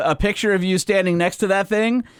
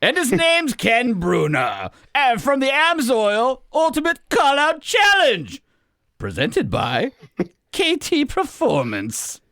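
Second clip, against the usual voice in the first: slow, drawn-out announcer voice, pitched up; official announcer voice; 7 semitones higher, slower than usual. Shown as a demonstration. Co-host giving the winner an official introduction, hammed-up announcer voice. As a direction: stretch each name like a ring announcer. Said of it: "official voice".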